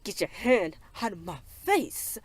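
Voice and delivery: slow, drawn-out mock outrage; mock indignant. [name] to the man she grabbed, mock indignant. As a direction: act out an indignant demand, stretching the words. Acted quarrel, [name] grabs him.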